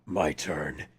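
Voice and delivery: very low, hushed hero growl; Batman. Acted. Fan quoting the original film's hero line, low menacing voice. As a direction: drop deep and quiet for a terse one-liner. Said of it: "in the original, he said".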